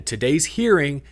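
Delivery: ordinary talk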